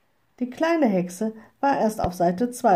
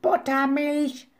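high, drawn-out screech; slow, high-pitched bird squawk; raven screech; 7 semitones higher than usual. A narrator's reading. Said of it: "kreischte Abraxas".

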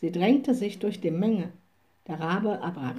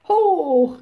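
loud, high cheering "Hoch"; crowd cheer; 8 semitones higher, 8 dB louder than usual. A narrator's reading. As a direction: shout it like the whole festival crowd. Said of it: "riefen abermals alle Leute".

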